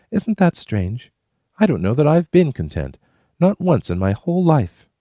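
put-on high, musing young woman; a wondering woman by the campfire; Kate's voice. A narrator's reading. Narrator voicing Kate, high and wondering.

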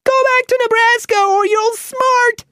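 high, loud mouse yell; squeaky yell. Acted. Mouse Bobbin threatening the wizard after biting him, squeaky yell. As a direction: shriek the threat from a safe distance. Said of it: "he yelled".

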